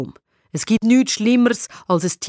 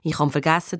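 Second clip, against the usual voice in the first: plain reading voice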